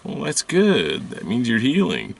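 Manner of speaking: hammed-up cheery healer brushing it off; mimicked healer reply. Healer answering the crying complaint in a mock sing-song.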